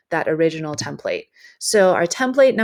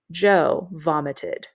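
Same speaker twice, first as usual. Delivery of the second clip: slow, punched surprise line; punchline delivery; slower than usual. Shown as a demonstration. Teacher showing students the surprise two-word sentence, slow and punchy. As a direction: drop it like a sudden twist. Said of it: "has more of a punch".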